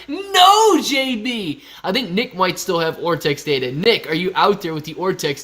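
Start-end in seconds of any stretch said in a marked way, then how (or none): 0.1-1.6 high, loud drawn-out "no"; loud exclamation. Real, not acted. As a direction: cry out in loud protest, pitch way up. Exclaims at a viewer's news.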